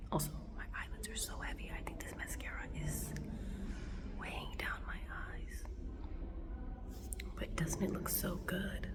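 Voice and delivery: soft unvoiced whisper; hallway whisper. Real, not acted. Whispering to camera outside the classroom. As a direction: whisper so the class can't hear. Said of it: "whispering in hallway outside class".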